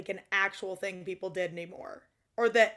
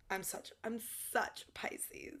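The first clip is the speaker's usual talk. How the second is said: whisper; 13 dB quieter, slower than usual. Real, not acted. Confessing her Pisces nature.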